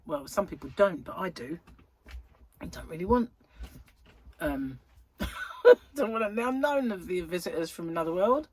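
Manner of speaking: ordinary delivery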